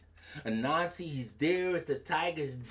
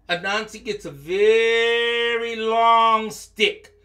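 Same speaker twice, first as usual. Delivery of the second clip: long, stretched words, higher and louder; drawn-out emphasis; 7 semitones higher, 7 dB louder than usual. Shown as a demonstration. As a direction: drag out longer to show how long. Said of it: "longer than long".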